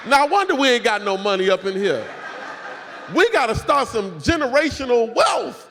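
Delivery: loud, high, laughing exclamation; laughing shout. Real, not acted. Joking to the crowd about money, laughing shout. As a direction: belt it out through laughter.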